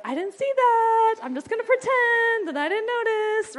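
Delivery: high, loud, hammed-up innocent denial; mock lazy mom. Tired mom ignores her misbehaving kid, hammed-up and high. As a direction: pretend you saw nothing, in a sing-song. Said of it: "sometimes it's easier just to be like".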